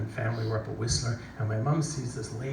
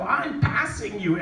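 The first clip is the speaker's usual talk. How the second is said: excited call; 6 semitones higher, 4 dB louder, slower than usual. Retold or imitated. Telling guide he is passing.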